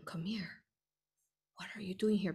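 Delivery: urgent hushed whisper; girl whispering to a boy in the crowd. Retold or imitated. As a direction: whisper it so the guards won't hear.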